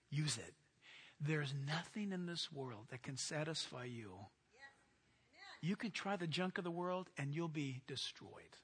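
hushed, low divine voice; voice of God. Acted. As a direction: speak softly as God. Voicing the Holy Spirit speaking.

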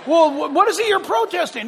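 high-pitched, incredulous questioning; mock street interviewer. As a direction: fire off questions like an exasperated street reporter. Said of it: "go out in the street and say".

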